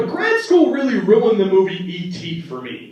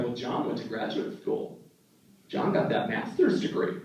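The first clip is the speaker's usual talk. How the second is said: hushed proud grandma; 7 semitones lower, 10 dB quieter than usual. Retold or imitated. Grandma bragging on phone.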